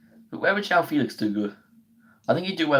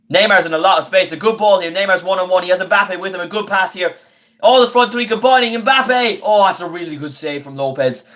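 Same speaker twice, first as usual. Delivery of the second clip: rising, louder football commentary that peaks as the attack builds; play-by-play commentary; 3 semitones higher, 4 dB louder than usual. Acted. Streamer calling the match for his viewers, excited play-by-play. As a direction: call the counter-attack like a TV commentator, climbing high at the chance.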